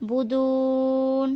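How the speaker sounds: বুদুন with the ু held three counts; slow, drawn বুদুন; three-alif stretch. Shown as a demonstration. Teacher shows the long ending, slow. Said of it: "এখানে তিন আলিফ টেনে পড়তে হবে".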